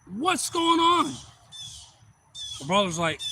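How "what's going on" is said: high, loud, drawn-out call; mock yell from a stranger upriver; imitated distant holler. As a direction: holler slowly across the water. Stranger calls out over the river.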